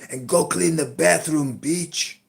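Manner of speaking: loud, high-pitched scolding yell; screaming at partner. Retold or imitated. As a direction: yell orders like a furious nagging partner. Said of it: "She starts screaming at me".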